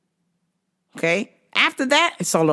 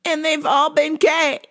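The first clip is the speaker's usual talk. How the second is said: higher, slow, rising to a wail; whiny teen girl voice; 9 semitones higher than usual. Retold or imitated. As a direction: mimic the upset cousin complaining. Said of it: "she's like".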